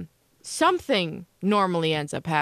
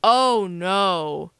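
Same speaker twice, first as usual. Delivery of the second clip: drawn-out, heavy "oh no" from dad; retold fatherly dismay, stretched out; dad's dismay; 4 dB louder than usual. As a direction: imitate a dad dreading the damage, slowly. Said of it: "He saw the picture and was like".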